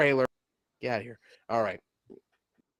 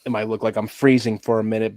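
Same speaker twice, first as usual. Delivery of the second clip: normal talking voice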